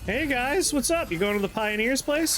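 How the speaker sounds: high bubbly VTuber voice; cute anime streamer. Acted. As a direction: chirp it like a perky streamer. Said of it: "makes us talk like a VTuber".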